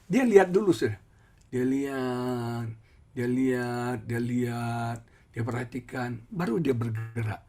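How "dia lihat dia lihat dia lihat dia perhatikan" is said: mimicking slowness. Imitating the slow driver.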